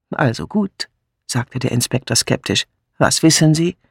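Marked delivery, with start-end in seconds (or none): none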